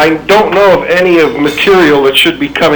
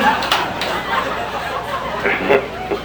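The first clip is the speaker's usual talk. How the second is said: breathy laugh; 12 semitones lower, 10 dB quieter than usual. Real, not acted. Unvoiced laugh as the prank dawns on him.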